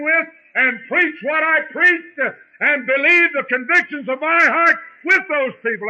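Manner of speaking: preaching. Acted. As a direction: preach it out, climbing and pressing. Preacher builds to full force.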